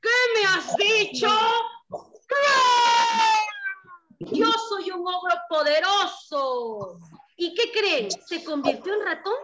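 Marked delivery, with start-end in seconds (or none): 0.0-7.0 angry ogre. Acted. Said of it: "El ogro se enojó".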